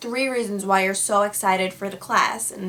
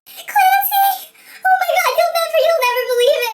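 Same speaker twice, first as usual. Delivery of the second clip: high-pitched, loud hysterical sobbing impression; Victoria crying hysterically; 7 dB louder than usual. Friend phones in hysterics, mock hysterical crying. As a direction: imitate a friend wailing down the phone, much higher. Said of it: "I get a phone call from her hysterically crying".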